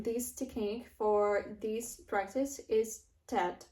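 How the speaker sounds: ordinary talk, nothing marked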